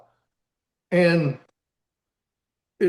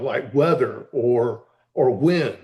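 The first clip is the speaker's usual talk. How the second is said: ordinary delivery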